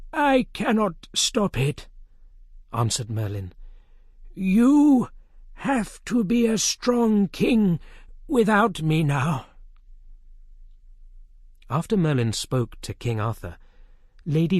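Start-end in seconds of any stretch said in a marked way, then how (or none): 0.1-9.5 higher, slow, solemn; a grave wizard giving final counsel; old wizard. A narrator's reading. Merlin urging Arthur to be strong.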